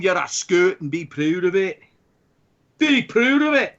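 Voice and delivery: hammed-up Scottish brogue; Scottish accent. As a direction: play the proud Scot, thick mock accent. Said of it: "my terrible Scottish accent".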